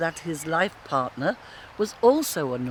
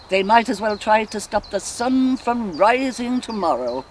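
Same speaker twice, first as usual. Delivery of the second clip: loud, higher-pitched dismissal; defiant scorn; 6 semitones higher, 6 dB louder than usual. Acted. Molly scoffing at those who deny God, loud and defiant. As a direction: throw it out defiantly, voice raised.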